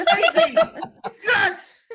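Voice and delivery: high, laughing through the words; laughing disbelief. Real, not acted. Comic laughing at the neighbor story, laughing disbelief. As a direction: crack up at how absurd it is.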